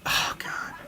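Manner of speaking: breathy whispered groan of dismay; a fan's whispered oh God; whispered despair. Real, not acted. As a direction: mutter it under your breath, defeated. Despairing aside after the loss.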